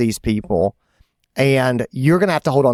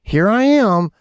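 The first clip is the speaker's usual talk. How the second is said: grumbling resentment; 7 semitones higher, slower than usual. Retold or imitated. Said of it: "that kind of mean feeling".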